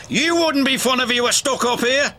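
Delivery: high-pitched indignant retort; cranky snap. A narrator's reading. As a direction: snap back crossly. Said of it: "snapped Cranky".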